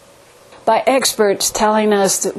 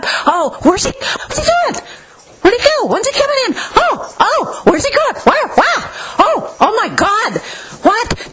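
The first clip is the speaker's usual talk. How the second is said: shrill, much higher panicking dog; frantic dog voice; 9 semitones higher than usual. Retold or imitated. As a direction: yelp it out like a frantic watchdog. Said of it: "a most unfortunate voice".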